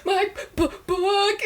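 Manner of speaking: high-pitched blubbering, stumbling over the word; comically exaggerated sobbing through the words; mock bawling. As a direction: wail it like a crybaby. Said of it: "I just started bawling".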